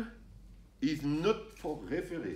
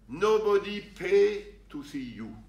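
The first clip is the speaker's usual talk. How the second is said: ordinary delivery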